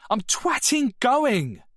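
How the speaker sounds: a very high, loud insult spat out; furious girl. Acted. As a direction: hurl the word at the boys.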